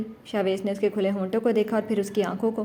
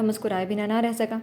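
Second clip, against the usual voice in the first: plain reading voice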